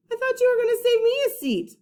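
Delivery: put-on hurt kid. Eugene complaining to Charlie.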